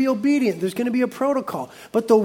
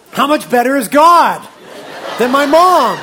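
preaching emphasis; 3 semitones higher, 7 dB louder than usual. Real, not acted. Preaching peak, laughing.

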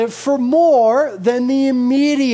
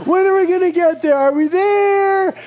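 put-on whining child; 7 semitones higher, 3 dB louder than usual. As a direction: whine it louder and louder. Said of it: "Every three seconds".

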